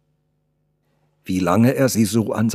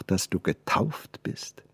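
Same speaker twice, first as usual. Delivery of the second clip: a slow, wondering whisper, much softer; 7 dB quieter, slower than usual. Acted. The artist marvels at the girl's name, slowly whispering.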